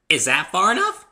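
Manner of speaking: high, loud, bouncy pony voice; high loud cheerful pony girl; bubbly Pinkie. A narrator's reading. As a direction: chirp it like an excitable pony.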